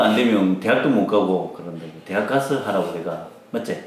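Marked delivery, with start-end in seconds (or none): none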